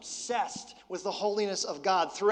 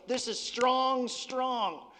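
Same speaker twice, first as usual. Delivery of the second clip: slow, high, wide-eyed jolt; like the caffeine just hit; jolted by espresso; 6 semitones higher, much slower than usual. Retold or imitated. Pastor imitating his first espresso sip, high and wide-eyed. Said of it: "my eyes would pop open and I would go".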